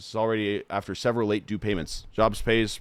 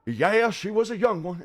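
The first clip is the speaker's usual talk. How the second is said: high, louder put-on voice brushing it off; pitched-up parody of a casual dismissal; mock filmmaker voice; 10 semitones higher, 5 dB louder than usual. Parodying the documentary, high put-on brushing-off voice. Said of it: "they were just like".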